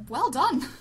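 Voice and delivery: usual speaking voice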